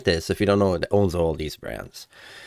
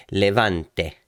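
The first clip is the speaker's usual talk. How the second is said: "Levante" in Italian, sounding the final e; Italian pronunciation. Shown as a demonstration. Host correcting himself, "Levante" with Italian accent. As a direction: say "Levante" the Italian way, three syllables. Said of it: "I say it in Italian".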